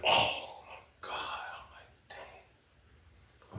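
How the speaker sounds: breathless whisper; hushed disbelief; stunned whisper. Retold or imitated. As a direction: whisper it in shock.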